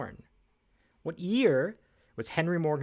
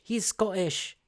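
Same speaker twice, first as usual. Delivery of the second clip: badly faked Scottish accent, pitched up; terrible Scottish accent; 5 semitones higher, slower than usual. Trivia host playfully gives the answer, bad Scottish accent. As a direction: have a clumsy go at a Scottish accent. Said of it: "that's a terrible Scottish accent".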